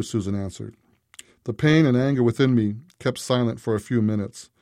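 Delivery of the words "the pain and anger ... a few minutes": ordinary delivery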